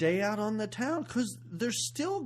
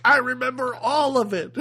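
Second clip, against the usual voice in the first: mock earnest son; 6 semitones higher, 9 dB louder than usual. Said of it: "And Patrick Swayze is like".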